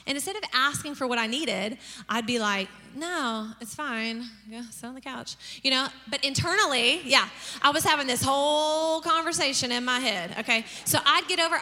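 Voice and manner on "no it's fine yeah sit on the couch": quiet, passive-aggressive retold reply; understated sarcastic resignation. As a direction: trail off softly, pretending it's fine. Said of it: "get real sarcastic".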